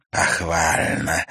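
slow muffled voice under a mask; muffled masked witch. A narrator's reading. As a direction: speak slowly from behind a mask. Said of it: "голос под маской звучал глухо".

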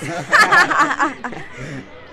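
big high laugh that falls away; loud burst of laughter. Acted. Boy bursting out laughing at friends' teacher joke, loud laugh. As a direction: crack up loudly at the joke.

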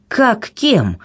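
high, indignant exclamation; crying out. A narrator's reading. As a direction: exclaim it in disbelief. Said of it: "выкрикнул Казимир".

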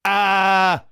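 high, loud held "aaah"; emotional scream. A narrator's reading. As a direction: scream to let out all the pent-up emotion. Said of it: "закричал он, выплёскивая все накопившиеся эмоции". (громкий крик, выплёскивая эмоции)

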